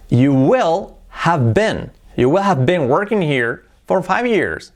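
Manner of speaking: slow, careful teacher model; slow model sentence. Shown as a demonstration. Teacher models the future perfect slowly for students. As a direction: stretch it out slowly for learners. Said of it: "¿Y cómo sería en futuro?".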